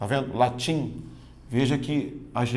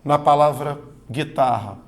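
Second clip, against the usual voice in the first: ordinary delivery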